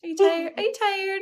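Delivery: high, sing-song cooing question; cooing baby talk. Pretend. Host reacting to co-host's yawn, cooing. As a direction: coo it as if to a sleepy baby.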